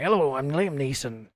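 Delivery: mock gruff Irish accent; lightly hammed-up gruff Irish impression; Liam Neeson. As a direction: imitate a failed American accent slipping into gruff Irish. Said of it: "comes out as this gruff Irish accent".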